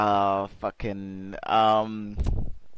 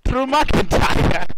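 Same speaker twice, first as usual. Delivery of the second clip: loud, high, drawn-out disbelief; incredulous outcry; 12 semitones higher, 7 dB louder, much slower than usual. Real, not acted. Podcaster balks at being told to be McIntyre, incredulous. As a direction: stretch the name out in loud disbelief. Handed an unwanted wrestler to imitate.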